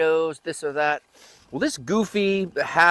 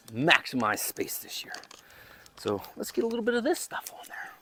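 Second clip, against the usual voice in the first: softer, breathy whispered aside; 3 semitones higher, 7 dB quieter than usual. Real, not acted. Gardener whispering to viewers while spreading bone meal. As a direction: hush it like sharing a secret.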